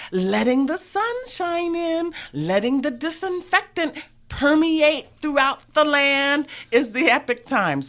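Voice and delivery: high, soaring slogan delivery; grand ad read. Real, not acted. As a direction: proclaim it like a rousing slogan. Declaims the sponsor's slogan.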